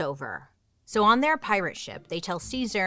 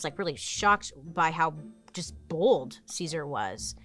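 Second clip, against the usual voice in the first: ordinary talk, nothing marked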